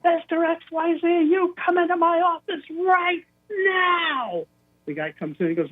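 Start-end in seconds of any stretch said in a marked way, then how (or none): 0.0-4.5 high-pitched furious summons, stretched to a bellow; angry boss announcement. Retold or imitated. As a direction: imitate an enraged boss shouting over the intercom. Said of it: "was so mad".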